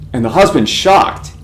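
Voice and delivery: ordinary delivery, nothing marked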